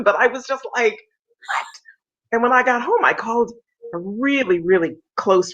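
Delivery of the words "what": whispered what. Retold or imitated. Stunned reaction to friend's ride.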